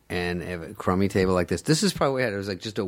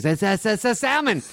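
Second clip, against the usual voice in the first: stammering, much higher mock protest; flustered customer; 13 semitones higher than usual. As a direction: sputter it in disbelief, way up high. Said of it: "He goes".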